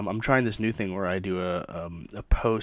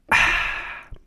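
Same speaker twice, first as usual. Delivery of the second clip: satisfied sigh after a sip; 6 dB louder than usual. Real, not acted.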